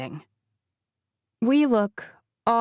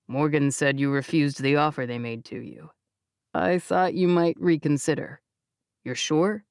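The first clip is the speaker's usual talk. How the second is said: lower, calm male voice; Jake's voice; 5 semitones lower than usual. Acted. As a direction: reason gently with her.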